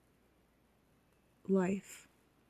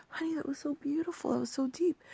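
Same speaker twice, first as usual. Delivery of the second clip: higher, softer, imitating a moved mom; tearful mother; 6 semitones higher, 5 dB quieter than usual. Mom to her little girl after her answer, imitated tearful awe. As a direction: gently act out a mother moved to tears. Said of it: "She was in tears".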